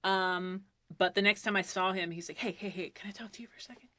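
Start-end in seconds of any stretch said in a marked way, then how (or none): 2.4-3.9 hushed, much softer aside; discreet request. Retold or imitated. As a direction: imitate him quietly asking for a private word. Director discreetly calls her aside.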